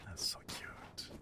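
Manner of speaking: soft, slow whisper of delight; whispered aww. Real, not acted. Streamer cooing at her cat nuzzling her hands, soft whisper. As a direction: melt over the needy cat, barely voiced.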